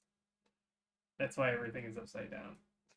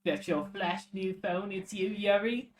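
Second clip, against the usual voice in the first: put-on British accent, pitched up; game character in a British accent; 6 semitones higher, 6 dB louder than usual. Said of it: "unaware that they had a British accent".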